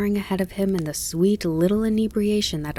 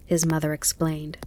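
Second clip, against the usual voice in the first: ordinary delivery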